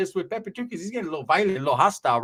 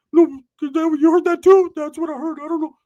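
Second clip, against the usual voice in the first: very high, loud cartoonish squeak; squeaky Ricky voice; 11 semitones higher, 8 dB louder than usual. Ricky squealing back at his teasing cousin. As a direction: squeal it out like Mickey Mouse.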